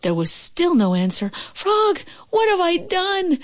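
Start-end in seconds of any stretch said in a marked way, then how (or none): 1.5-3.4 high, slow, remorseful cry; anguished cry. Acted. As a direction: regret it all at once. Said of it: "cried Toad".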